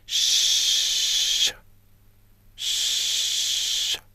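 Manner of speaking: soft unvoiced "Chut" hissed out long; shh hiss. Shown as a demonstration. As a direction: push out a quiet shushing breath. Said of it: "Faites-le avec moi".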